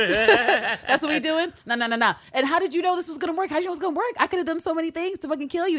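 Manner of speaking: high-pitched mock disbelief; incredulous. Voicing the assassin questioning her rival's risky plan, incredulous.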